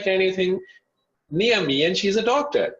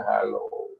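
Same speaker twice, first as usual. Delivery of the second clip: heavy low voice; 7 semitones lower, 4 dB quieter than usual. Retold or imitated. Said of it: "it was a very heavy voice".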